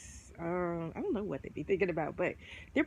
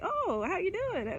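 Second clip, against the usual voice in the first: higher, pleased mock Leo reply; flattered Leo; 12 semitones higher than usual. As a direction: play the Leo, instantly won over, pitched up. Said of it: "and they're just like".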